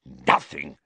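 low, scornful echo; contemptuous low snarl; spat out. A narrator's reading. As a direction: spit the word back in disbelief. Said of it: "spat Filch".

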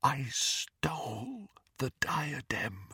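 slow, soft whisper. A narrator's reading. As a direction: whisper the confession, drawn out. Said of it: "repeated Helena Ravenclaw in a whisper".

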